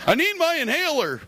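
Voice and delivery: high, pleading mock whine; whiny wheeze. Tied-up buddy begging for his inhaler, high mock whine. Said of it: "They're like".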